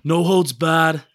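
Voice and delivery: put-on loud copy of an accent, Godfather-like Philly; exaggerated Philly accent, punched out loud; Dave Gettleman. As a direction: echo it in a Philly mob-boss accent. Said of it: "So let's compare it".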